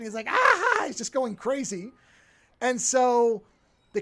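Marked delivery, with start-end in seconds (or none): 0.3-0.8 high, loud ecstatic whoop; crazed gleeful cry. Retold or imitated. Villain bathing in gold, a high crazed cry of glee. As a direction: let out a manic cry of glee. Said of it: "And he's laughing".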